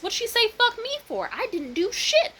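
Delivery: high, loud and indignant; shrill outraged storytelling; loud outraged retelling. Real, not acted. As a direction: shout it out in disbelief. Yelling about attack at bed.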